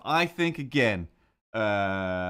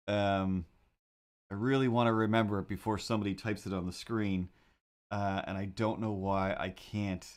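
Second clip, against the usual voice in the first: ordinary talk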